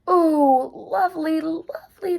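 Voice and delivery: high delighted squeaks; high happy mouse squeaks; squeaky mice. A narrator's reading. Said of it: "they squeaked".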